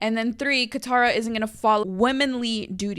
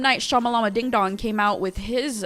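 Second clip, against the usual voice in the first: normal talking voice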